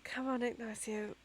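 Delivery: helping a friend to bed, quietly whispered; soft whisper. Acted. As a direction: gently whisper him along. Kavoria helps Ignacio to bed.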